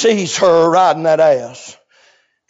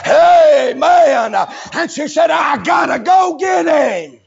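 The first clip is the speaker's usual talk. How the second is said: preaching at full force; 7 semitones higher than usual. Acted. Preacher shouting the story to the church. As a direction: whoop it out to the congregation.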